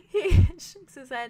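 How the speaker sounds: high, loud, tearful laughter in the words; laughing through narration. Real, not acted. Player resumes Kai's action after breakdown, laughing helplessly.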